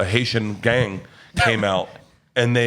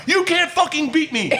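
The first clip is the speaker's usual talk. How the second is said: even higher triumphant yell; taunting yell. Retold or imitated.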